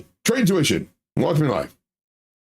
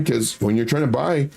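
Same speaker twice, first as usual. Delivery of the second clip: ordinary talk, nothing marked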